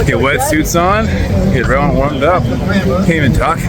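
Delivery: talking with a frozen mouth. Real, not acted. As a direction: lips too cold to talk. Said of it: "my mouth is frozen".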